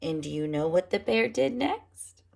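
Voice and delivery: usual speaking voice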